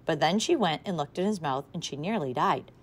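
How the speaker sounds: usual speaking voice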